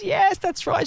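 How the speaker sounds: high-pitched squealed agreement; mock scream. Client confirms the red car was better, mock scream. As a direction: imitate her screaming, high but not loud. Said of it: "She screams out even louder".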